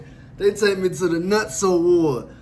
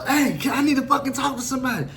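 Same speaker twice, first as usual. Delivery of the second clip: higher, urgent calling through a door; desperate calling; 6 semitones higher, faster than usual. Retold or imitated. Inmate banging on the cell door, calling to guards. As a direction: plead to be let out.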